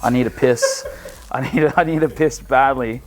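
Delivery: ordinary talk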